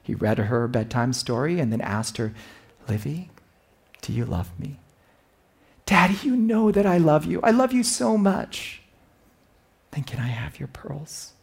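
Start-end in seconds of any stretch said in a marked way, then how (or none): 2.9-4.8 quiet, gentle ask; soft father question. Retold or imitated. Father at bedtime asking his daughter softly. 5.9-8.8 put-on high child reply; sweet little girl. Daughter answering her father at bedtime. 9.9-11.3 hushed, breathy whisper; whispered ask. Retold or imitated. Father whispering to his daughter at bedtime.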